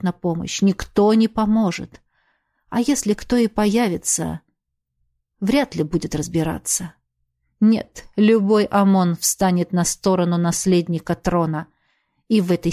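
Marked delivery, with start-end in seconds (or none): none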